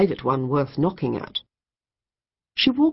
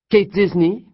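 sharp, demanding call of her name; demanding French woman; 4 semitones higher, 6 dB louder than usual. A narrator's reading. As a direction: call her name like you own the room. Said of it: "she demanded".

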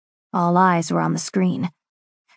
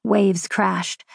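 plain reading voice

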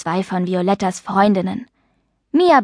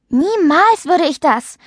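high, slow, emphatic denial; outraged, higher-pitched denial; honest indignation; 8 semitones higher, 3 dB louder, slower than usual. A narrator's reading. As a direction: protest indignantly, leaning on the first word. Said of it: "Paula war ehrlich empört".

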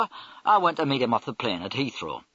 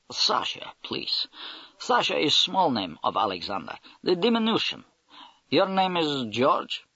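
put-on Russian accent; 4 semitones higher than usual. Russian major's voiced replies.

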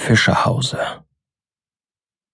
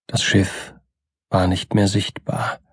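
hushed, fading ending; 7 dB quieter than usual. A narrator's reading. Reciter closing a sea poem as darkness falls, hushed and fading.

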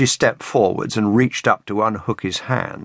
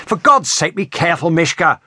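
high, exasperated snap; irritated snap; 8 semitones higher than usual. A narrator's reading. Man warning his whip-wielding partner, irritated snap. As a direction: snap it out, pitched up. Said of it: "Warwick snapped".

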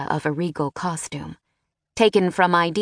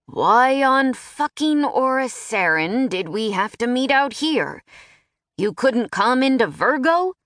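grumbling; 6 semitones higher than usual. Acted. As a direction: gripe about the meeting place. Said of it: "the wizard grumbled".

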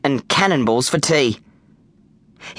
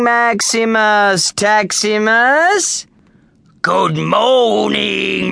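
very slow, drawn-out chant; slow drawl; 10 semitones higher, 4 dB louder, much slower than usual. Acted. Gladiators reply to the trainer in a slow drawl. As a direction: drag every syllable out. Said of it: "replied in a slow drawl".

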